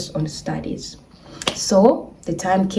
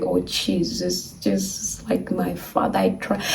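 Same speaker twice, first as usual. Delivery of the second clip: soft, slow murmured prayer; hushed praying; 12 semitones lower, 9 dB quieter than usual. Retold or imitated. Praying before the test result, hushed and slow. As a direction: pray under your breath, pleading. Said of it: "I started the prayers".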